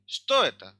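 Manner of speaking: श्तोयता said high and loud, falling at the end; rising-falling question tune. Shown as a demonstration. Teacher showing students the question-word intonation. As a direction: lift श्तोयता up high, then drop it. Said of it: "So हम इसको कैसे पढ़ेंगे".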